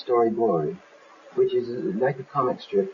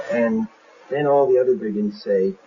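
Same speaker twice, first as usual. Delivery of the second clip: usual speaking voice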